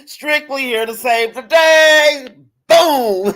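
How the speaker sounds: slow triumphant belt with a punchy finish; mock-heroic shout. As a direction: proclaim it like a cartoon rescuer. Quotes viewer as rescuing superhero.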